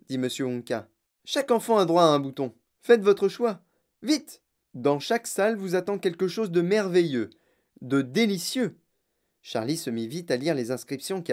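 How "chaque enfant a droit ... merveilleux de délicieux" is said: higher, lively urging with relish; showman inventor, eager. Acted. Inventor urges children to pick a button, eagerly. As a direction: hurry them on, then savour the promise.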